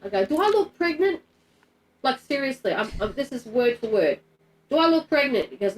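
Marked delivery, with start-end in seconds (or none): 0.2-1.2 high-pitched, disbelieving question; incredulous retort. Retold or imitated. Disputing the doctors' pregnancy verdict. 4.7-5.5 high, louder disbelieving question; incredulous retort. Retold or imitated. Repeats her disbelieving retort word for word.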